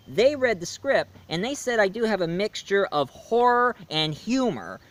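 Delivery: ordinary talk, nothing marked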